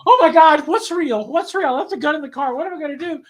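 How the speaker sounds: high-pitched, frantic mock alarm; imitated panic. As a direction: act out someone panicking at a monster. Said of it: "She's going".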